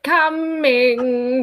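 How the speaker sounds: Frank-N-Furter's "Coming". Retold or imitated. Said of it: "there's lines where he's like".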